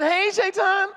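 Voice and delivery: high, gleeful sing-song hello; friendly mock greeting. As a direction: break off to greet an old pal. Greets the devil mid-prayer.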